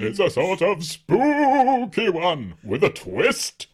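high, slow spooky announcer. Acted. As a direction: ham up the creepy twist. Spooky delivery of a twist.

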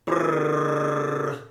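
rolled-lips drumroll sound; drum roll with mouth. Acted. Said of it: "do a drum roll with your mouth".

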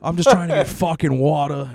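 hammed-up yell at security; mock angry junkie.

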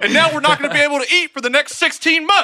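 high, loud mock farmer tirade; mad farmer. Farmer telling wife they will starve, mock fury. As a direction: yell it as a hammed-up outraged farmer. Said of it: "how mad can you be as a farmer?".

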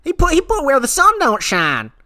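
very high, twangy hillbilly gossip voice. Acted. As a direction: cackle out gossip like a backwoods yokel. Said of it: "I'm the rodeo clown".

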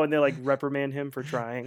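ordinary talk, nothing marked

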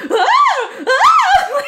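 high laughing screams; mock scream. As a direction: shriek in giggly fright. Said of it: "laughing hysterically, going like".

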